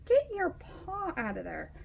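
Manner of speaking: high pet-talk voice. Real, not acted. Talking to dog on lap.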